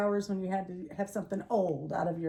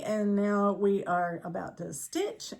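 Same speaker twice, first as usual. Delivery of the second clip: ordinary talk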